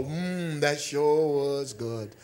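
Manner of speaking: slow, relishing "mm" of pleasure; savoring satisfaction. Acted. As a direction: act out savoring a craved dessert. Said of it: "when you get it, you go".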